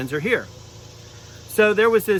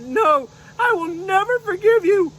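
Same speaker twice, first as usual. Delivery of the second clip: very high, indignant unicorn refusing; little unicorn outraged over her mane; upset unicorn puppet; 13 semitones higher than usual. Pretend. Unicorn puppet refusing the dragon, very high and upset. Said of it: "she said".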